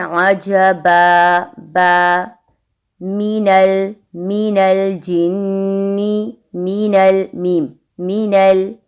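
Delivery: slow, drawn-out Arabic recitation of मीनल; Quranic recitation demo. Shown as a demonstration. Teacher recites Arabic examples for the class slowly. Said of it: "जैसे हम कहते हैं".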